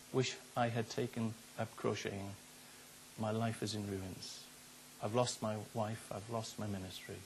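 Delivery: low, soft, sorrowful reading; subdued regret. Retold or imitated. Young pastor's letter about his ruined life, subdued regret. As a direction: read the confession quietly and heavily.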